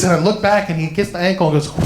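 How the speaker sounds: normal talking voice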